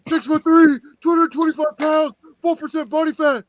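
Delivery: high, loud ring-announcer hype; wrestling promo. Acted. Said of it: "you're cutting a Lex Luger wrestling promo".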